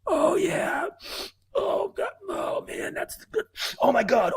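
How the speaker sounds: squealing falsetto, pretending to get high; mock high ecstasy.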